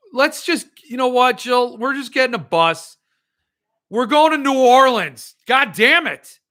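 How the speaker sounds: thrilled shout announcing a road trip; excited holler. Real, not acted. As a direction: yell it out to Jill like the trip is happening right now. Hollering about a New Orleans trip.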